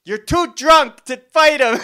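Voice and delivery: high, loud, drawn-out mom shriek; mock motherly scolding; yelling mom. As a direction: imitate a mother hollering at a drunk brawler. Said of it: "then you hear the mom".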